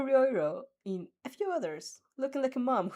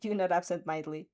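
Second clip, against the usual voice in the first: ordinary delivery, nothing marked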